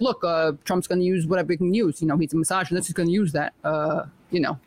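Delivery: a mild mock Bernie, explaining patiently; imitating Bernie. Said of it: "I'm sure he was like".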